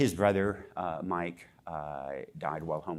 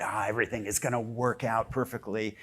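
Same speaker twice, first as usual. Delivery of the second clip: lightly put-on naive optimism, pitched up; mock blind optimism; 5 semitones higher, 4 dB louder than usual. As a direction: lightly imitate a blindly hopeful believer. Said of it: "this sort of blind Panglossian hope".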